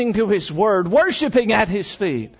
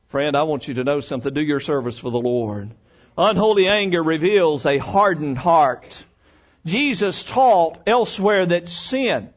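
ordinary delivery

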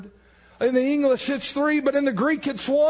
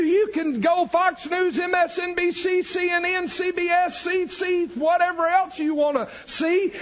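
normal talking voice

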